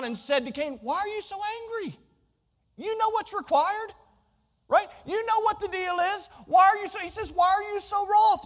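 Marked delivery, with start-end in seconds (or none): 0.8-7.0 higher-pitched voice of God gently asking Cain why; God's voice, probing and higher; God questioning Cain. Acted. 7.3-8.4 higher-pitched voice of God gently asking Cain why; God's voice, probing and higher; God questioning Cain. Acted.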